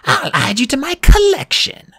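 high, loud furious threat; snarling with rage. A narrator's reading. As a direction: screech a threat in fury. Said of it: "she snarled".

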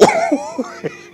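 delighted high laugh. Retold or imitated. Laughter after amazed whoa.

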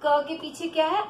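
normal talking voice

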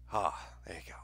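a breathy, spooked whisper; hushed, mock-scared whisper. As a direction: whisper it as if something is watching. Spooked by the death note.

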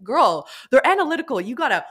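put-on high, loud sassy girlfriend advice; sassy pep talk. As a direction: tell her to breathe, hyped and bossy. Coaching a client, sassy advice.

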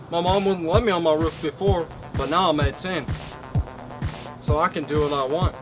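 usual speaking voice

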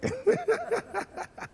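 long high-pitched laugh. Real, not acted. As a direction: laugh at the absurd win. Laughs at seven feet win.

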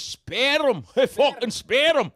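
loud, high Scottish "sperrum"; Scottish accent. Acted. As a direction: belt it out in rolled-R Scottish. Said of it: "he was like this".

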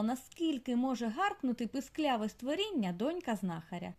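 usual speaking voice

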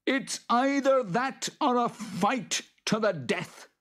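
high-pitched threatening creature growl; growling boggart. A narrator's reading. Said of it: "growled the boggart".

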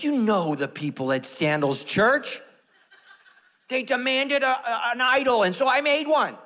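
high, loud comic protest; hammed-up excuse. Dan blaming the church to pastor, hammed-up excuse. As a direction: plead your case at a mock shout. Acted colleague blaming congregation.

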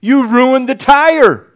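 loud and slow, driving the point home; slow emphatic preaching. Real, not acted. Preacher driving home a lesson to the congregation, loud and slow. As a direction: hammer each word slowly and loudly.

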